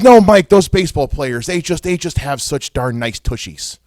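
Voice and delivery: put-on grandma with a Minnesota accent, loud high opening. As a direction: play a grandmother in Minnesota dialect. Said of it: "gave my grandmother a, a Minnesota accent".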